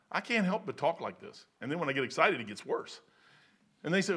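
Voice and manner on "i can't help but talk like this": usual speaking voice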